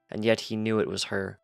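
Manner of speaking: ordinary delivery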